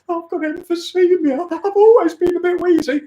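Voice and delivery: very high put-on voice, pleading; pitiful excuse. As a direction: plead your frailty in a squeaky voice. Acted jogger stopped by officer.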